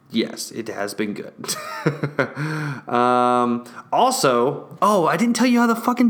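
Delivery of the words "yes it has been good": low settled murmur; soft low affirmation. Real, not acted. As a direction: lower and softer, contented. Soft repeat.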